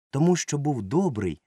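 usual speaking voice

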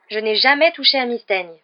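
indignant, emphatic denial; vigorous protest. A narrator's reading. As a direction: protest your innocence with vigour. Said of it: "protesta Harry avec vigueur".

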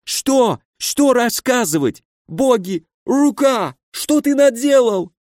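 high-pitched, pained wailing; panicked injured baron. A narrator's reading. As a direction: plead in high, agonised bewilderment. (высокий страдальческий голос перепуганного барона)

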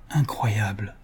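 breathless, whispered disbelief. A narrator's reading. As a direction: hush the word in amazement. Fils stupéfait, chuchote. (murmure incrédule, chuchoté)

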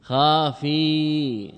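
خافي said very slowly with a falling end; drawn-out word. Shown as a demonstration. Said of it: "خافي، خافي". (خافي ممدودة ببطء بنبرة هابطة)

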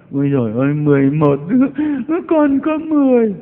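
hammed-up lament rising into a high keen; mock wailing. Mother of eleven blaming heaven, mock wailing. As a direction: wail reproachfully at heaven, climbing high. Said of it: "khóc mà đay nghiến ông trời".

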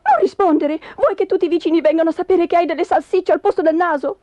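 fast, high, agitated scolding; high, loud flustered wife; urgent panic. Acted. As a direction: hurry out the warning before anyone answers. Wife panics as neighbour knocks.

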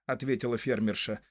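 usual speaking voice

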